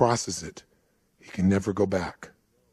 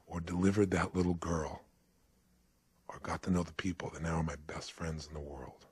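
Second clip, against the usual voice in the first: low, subdued reassurance; calm gentle correction; 5 semitones lower, 6 dB quieter than usual. Retold or imitated. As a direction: lower the voice and answer gently, sinking softer. Old doctor gently answers, retold.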